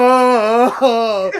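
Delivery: imitated crowd eruption, drawn out; imitating the crowd going wild with a long whoa; crowd roar whoa. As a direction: let out a mock arena roar.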